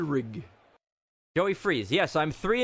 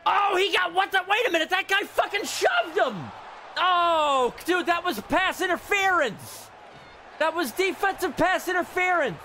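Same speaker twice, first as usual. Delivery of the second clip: outraged protest; 12 semitones higher than usual. Real, not acted. Gamer protesting pass interference to the game, outraged. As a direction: yell in high-pitched disbelief at the foul.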